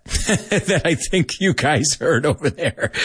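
amused, giggling through the phrase; laughing. Real, not acted. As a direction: talk through a real chuckle. Laughs while repeating the phrase.

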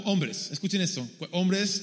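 ordinary talk, nothing marked

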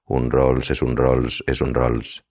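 a slow, fading hypnotic chant; slow, trancelike. A narrator's reading. The narrator sinks into a slow, fading inner chant. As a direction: drift off repeating "un Rolls es un Rolls".

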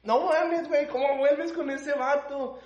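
mucho más agudo, alargando la pregunta; no puede creer que volvieron; asombro incrédulo. Real, not acted. Reacción al final de la historia. (asombro incrédulo y agudo)

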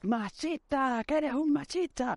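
high, drawn-out mock shouting; retold yelling. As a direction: re-enact an outraged yell, high and stretched. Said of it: "me puse a gritarle al tío".

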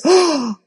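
sharp surprised gasp; demo gasp. Shown as a demonstration. Coach shows how to react to a stranger with a gasp. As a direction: gasp as if amazed at the answer. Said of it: "do this".

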